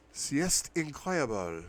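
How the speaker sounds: high, excited "C'est incroyable" said slow, each syllable drawn out; exclaiming. Acted. Mia raving about Paris to her brother, exclaiming "C'est incroyable" high and slow. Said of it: "she exclaims".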